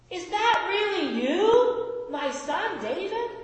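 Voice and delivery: stunned king calls back to his son; calling back in disbelief. Acted. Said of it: "Saul called back".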